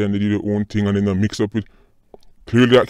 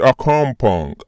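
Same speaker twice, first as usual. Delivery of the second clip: lightly mocking American twang; mimicked American accent; 3 semitones higher, slower than usual. Vlogger mimics the banker for viewers, mock American accent. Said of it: "People, this man said".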